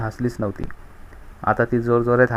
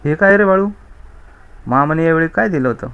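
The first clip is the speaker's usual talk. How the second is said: higher, louder voice of a surprised mother; mother asking; 5 semitones higher, 4 dB louder than usual. Acted. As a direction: play the startled mother questioning her son. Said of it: "आईने विचारले".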